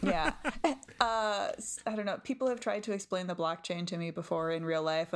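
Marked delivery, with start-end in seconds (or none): none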